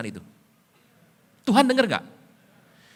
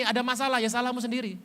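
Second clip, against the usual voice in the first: ordinary talk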